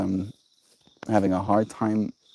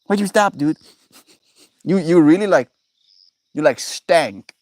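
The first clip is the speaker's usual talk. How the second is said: voicing the dog; 6 semitones higher, 4 dB louder than usual. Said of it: "She's like".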